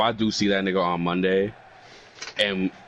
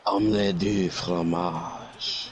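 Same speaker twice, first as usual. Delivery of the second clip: very slow, savoured mock French; 3 semitones lower, much slower than usual. Echoing the Dexter joke, slow mock French. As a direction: drag out the French phrase lazily. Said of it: "all he learned was omelette du fromage".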